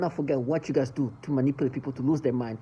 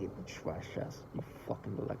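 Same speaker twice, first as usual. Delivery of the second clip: hissed insult; 7 semitones lower, 15 dB quieter than usual. Real, not acted. Insulting the abusers under her breath, a voiceless hiss.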